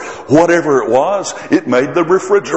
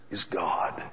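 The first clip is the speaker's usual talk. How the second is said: whisper; 5 semitones lower, 15 dB quieter than usual. Acted. Quoting Hebrews, dropping to whisper.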